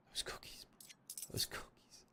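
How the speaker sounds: hushed whispered craving; very soft, voiceless murmur. Real, not acted. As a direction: whisper it longingly to yourself. Quiet craving aside about cookies.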